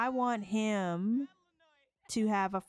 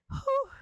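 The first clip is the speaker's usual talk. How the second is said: high whew. Real, not acted. Hyping the Patreon scene.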